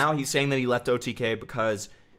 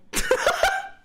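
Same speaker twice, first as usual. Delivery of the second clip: high squealing laugh; 3 dB louder than usual. Real, not acted. Reacting to a vagina joke.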